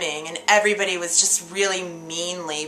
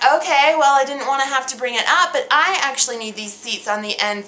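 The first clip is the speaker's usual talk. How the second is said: loud, higher, over-projected protest; stagey projection; 4 semitones higher, 5 dB louder, faster than usual. Acted. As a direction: announce it to the whole room. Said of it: "why are you speaking like that".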